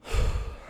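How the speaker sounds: long weary exhale; tired sigh. Real, not acted. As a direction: let out a heavy sigh. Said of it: "Catch my breath there".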